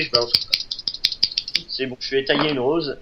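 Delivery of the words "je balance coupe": breathless whisper; whispered aside. Acted. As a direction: drop to a hushed whisper. Whispered aside after the attack.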